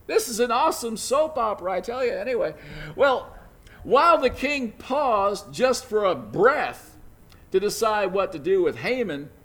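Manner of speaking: normal talking voice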